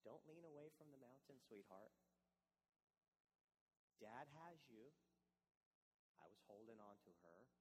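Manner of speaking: put-on calm a scared child with a quiet voice; soft reassuring hush. Father soothing his frightened daughter on the cliff, hushed and tender. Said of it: "what I whispered in her ear".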